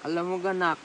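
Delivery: barely holding the words together. Real, not acted. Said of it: "ay lasing na lasing".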